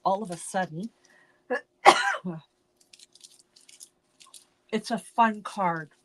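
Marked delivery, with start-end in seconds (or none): none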